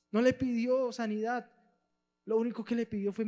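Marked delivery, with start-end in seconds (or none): none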